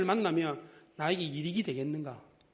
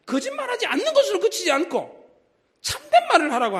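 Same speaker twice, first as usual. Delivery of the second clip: higher and louder sermon call; fervent preaching; 11 semitones higher, 7 dB louder than usual. Acted. Preacher urges the thief to do good, fervent. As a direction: drive the command home to the congregation.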